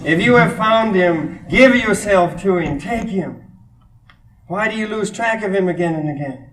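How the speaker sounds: high-pitched, declaimed verse; poem recitation. Acted. Continues reading the poem aloud.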